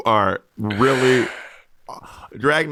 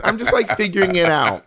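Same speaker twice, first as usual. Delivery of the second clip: mock noncommittal; 7 semitones higher than usual. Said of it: "Patty's still gonna be like".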